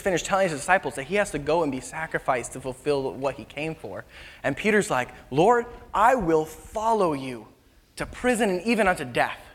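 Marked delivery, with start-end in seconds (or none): 5.3-9.4 bold vow. Acted. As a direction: proclaim it grandly. Peter's bold vow to Jesus.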